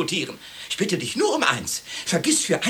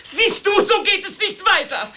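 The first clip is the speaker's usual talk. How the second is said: laughing high at the absurdity; high laughing exasperation; exasperated laugh; 13 semitones higher, 3 dB louder than usual. Acted. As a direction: laugh it out in disbelief. Absurd mutual agreement, exasperated.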